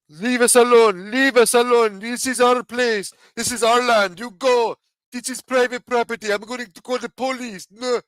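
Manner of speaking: high-pitched, loud indignant rebuff; mock hostile. Imitated kid confronted by a questioner, mock hostile. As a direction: play a hammed-up hostile local in a high voice. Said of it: "then get hostile with you".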